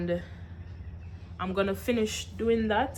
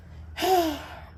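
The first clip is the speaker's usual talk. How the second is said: heavy sigh; 8 semitones higher than usual. Real, not acted. Sigh over the phone trouble.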